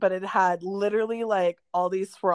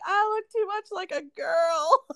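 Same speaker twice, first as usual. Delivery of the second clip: mock whiny crying; 12 semitones higher than usual. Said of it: "and you're like".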